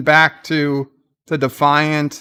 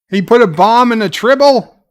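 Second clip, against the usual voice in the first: surprised; 6 semitones higher, 4 dB louder than usual. Real, not acted. Shocked by the villain's plan.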